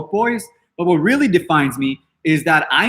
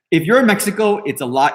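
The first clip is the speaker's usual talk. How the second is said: ordinary talk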